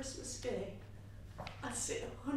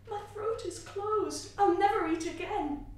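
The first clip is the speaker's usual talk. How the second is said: put-on higher, choked child's voice; stubborn grief, close to tears; tearful; 8 semitones higher, 7 dB louder than usual. A narrator's reading. Grieving child refusing food.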